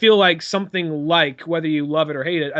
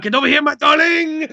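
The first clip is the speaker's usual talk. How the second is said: high, loud cartoon French lover; Pepe Le Pew; 10 semitones higher, 6 dB louder than usual. Amorous cartoon skunk chasing another car, hammed-up French. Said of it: "Pepe Le".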